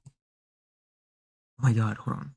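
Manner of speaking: ordinary talk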